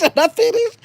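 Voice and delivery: playful high repeat. Retold or imitated. Repeating chat message, pitch jump.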